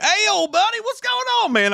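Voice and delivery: very high, loud Southern greeting; hammed-up good-old-boy Southern whoop; good-old-boy accent. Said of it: "A lot of good old boys".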